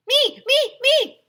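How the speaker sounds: very high loud eager shout; clamor like boys wanting a turn. Acted. Boys begging the clown for a turn, high loud shout. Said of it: "shouted all the boys".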